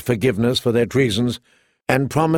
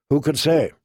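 plain reading voice

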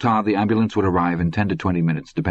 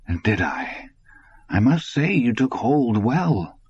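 slow, drawn-out mockery with a higher lilt; mocking; 5 semitones higher, slower than usual. A narrator's reading. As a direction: taunt with lifted, stretched words. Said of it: "the old mockery was in Dusander's voice".

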